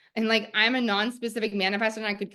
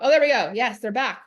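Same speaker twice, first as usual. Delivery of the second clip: relieved surprise; 3 semitones higher, 7 dB louder than usual. Real, not acted. Comments reappear on stream.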